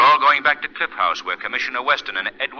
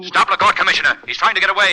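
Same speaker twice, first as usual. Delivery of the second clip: louder, higher urgent call; urging the commissioner to stop him; urgent shout; 6 semitones higher, 5 dB louder, faster than usual. Acted. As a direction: raise the alarm. Urging police to stop escapee.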